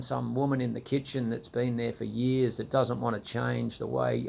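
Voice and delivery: ordinary delivery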